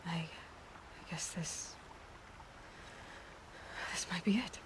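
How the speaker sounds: whispered farewell. Acted. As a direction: let the goodbye barely come out. Final farewell to Rost.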